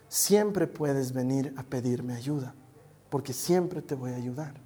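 low, slow, softened promise; tender reassurance. Retold or imitated. Father promising his daughter help, tender reassurance. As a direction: promise gently, without hurry.

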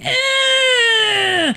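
long, very high mock scream; imitated kid's scream. Kid screams at the departing hero, high imitated scream. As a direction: screech one drawn-out cry as the kid.

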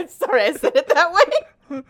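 high, loud laughing apology. Real, not acted. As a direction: giggle through it.

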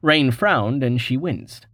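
ordinary delivery, nothing marked

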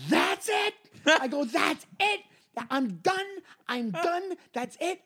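high, clipped mock finality; fake-cheerful door-closing; fed-up snap. Comic retelling how he cut off the host, high and fed up. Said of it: "Something in me just snapped".

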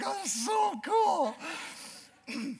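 high, drawn-out gasp of wonder; awed delight. Retold or imitated. Hunter amazed by the rancher, awed delight. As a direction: let the awe spill out slowly.

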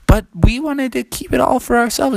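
put-on high, pleading kid voice; whiny protest. Frogs argue with mom, whiny protest. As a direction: plead childishly against the order.

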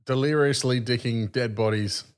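ordinary delivery

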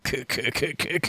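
soft evil cackle; little mock evil cackle; villain cackle. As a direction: cackle quietly like a schemer. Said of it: "just like".

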